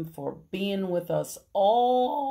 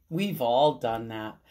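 usual speaking voice

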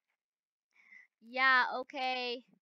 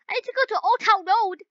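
panicked rush; 9 semitones higher, 7 dB louder, faster than usual. Real, not acted. Panicked driving in game.

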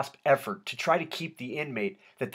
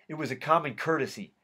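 plain reading voice

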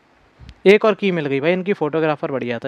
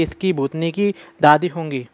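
ordinary talk, nothing marked

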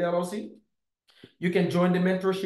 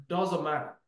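ordinary talk

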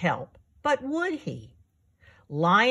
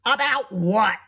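grumpy lion, slightly raised voice; grumpy snap; 3 semitones higher, 4 dB louder than usual. A narrator's reading. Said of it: "snapped Lion in his grumpiest voice".

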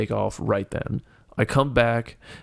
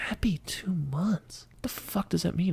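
whispered, high, disbelieving; hushed, stunned whisper of disbelief; baffled whisper; 8 semitones higher, 6 dB quieter than usual. Retold or imitated. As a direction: whisper it to yourself in total confusion. His baffled inner reaction.

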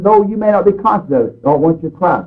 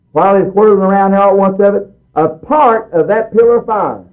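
raised, intense preaching voice, slowing on key lines; fervent preaching; 4 semitones higher than usual. Acted. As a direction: build it like a revival preacher. Preaching with rising fervour.

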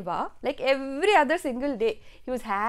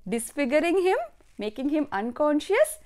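plain reading voice

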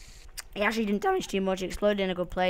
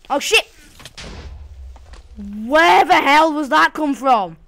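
loud, higher, slow shocked yell; startled shout; 6 semitones higher, 9 dB louder, slower than usual. Real, not acted. Player finding a mob inside, shouting in shock. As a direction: yell it in real alarm. Mob suddenly appears in house.

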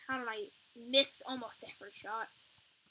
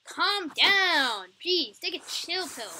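loud exasperated scold; 5 semitones higher, 12 dB louder, slower than usual. Real, not acted. Scolding an enraged ghost.

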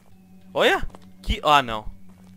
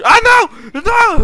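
panicked scream; 10 dB louder, slower than usual. Real, not acted. Creature jumps at him.

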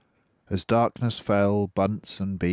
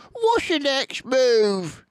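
high, slow, louder question; squeaky dwarf voice; 6 dB louder, slower than usual. Acted. Bunce asking Bean, high squeaky voice. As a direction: ask it in a squeaky little voice.

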